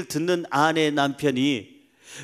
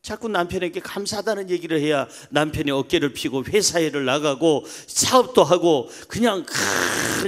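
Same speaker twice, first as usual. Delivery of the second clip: usual speaking voice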